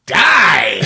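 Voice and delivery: high, loud strained yell; Robert Loggia. Acted. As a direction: scream it as a furious command. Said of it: "Robert Loggia's just like".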